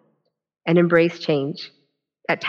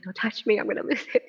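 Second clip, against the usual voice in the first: higher, lightly acted fragile warning; retold near-tears plea; 5 semitones higher, 3 dB quieter than usual. Speaker warns crying colleague off, playfully on the verge of tears. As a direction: act out barely holding it together, pitch lifted.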